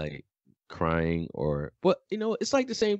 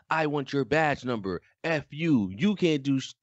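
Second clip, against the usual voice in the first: put-on higher, mouthy kid mouthing off to a cop; entitled mouthy kid; 8 semitones higher than usual. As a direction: snap at the cop like an entitled teen. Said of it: "Timmy would be all in your face".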